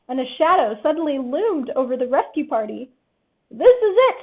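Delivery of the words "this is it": high loud panicked squeak. A narrator's reading. Rabbit as a shadow looms, panicked squeak. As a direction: shriek it in panic. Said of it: "Rabbit squeaked".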